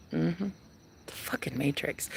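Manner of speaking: unvoiced hushed aside; whispered aside about escaping. Real, not acted. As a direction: drop to a secret whisper.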